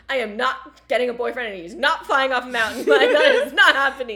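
cracking up while swearing he won't go over; laughing while quoting herself. Real, not acted. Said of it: "I'm, like, sobbing".

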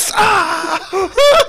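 high-pitched yelp of agony; high pained scream. Acted. Maced at the dinner table, screaming. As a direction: scream as if just sprayed with mace. Boss gets maced in the skit.

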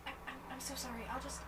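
ordinary delivery

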